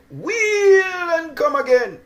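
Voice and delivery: high, loud, long-drawn call; belted out, very high and drawn out; hollered catchphrase. Acted. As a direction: holler it like a rewind call, stretching the first word. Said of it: "As they say".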